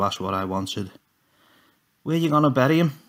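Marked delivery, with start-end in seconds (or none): 2.0-3.0 high shouted call down the landing; shouting after Tommy down the landing. A narrator's reading. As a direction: call after him loudly. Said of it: "I shouted".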